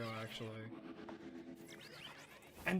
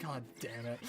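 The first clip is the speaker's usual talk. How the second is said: muttered resignation; 6 semitones lower, 8 dB quieter than usual. Retold or imitated. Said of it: "And I'm like".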